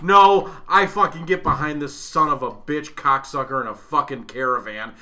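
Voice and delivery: ordinary talk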